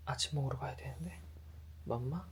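soft breathy whisper. Real, not acted. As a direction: hush it under your breath. Whispered aside near sleeping child.

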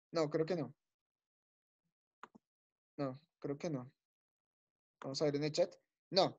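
low, very soft aside; talking to oneself. Real, not acted. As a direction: think aloud quietly while waiting. Talking to self while awaiting student. (hablando bajito para sí misma)

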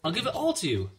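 put-on high, eager girl's plea; urging. A narrator's reading. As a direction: urge him on in a high young voice. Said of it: "Ellen urged him".

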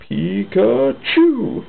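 slow, building creature cry; Pikachu attack cry. Acted. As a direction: stretch each syllable into a rising attack call. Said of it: "powers up an attack of his own and goes".

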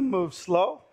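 ordinary delivery

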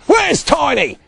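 high, loud cry. A narrator's reading. Big Bob looking for his dog, crying out. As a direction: call out urgently. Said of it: "cried Big Bob".

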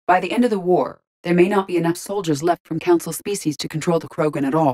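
ordinary talk

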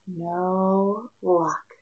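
plain reading voice

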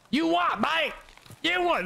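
very high-pitched, hammed-up British yelp; silly high British caricature; mock British accent. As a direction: squawk it as a bad British accent. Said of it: "Your British accent is something to behold".

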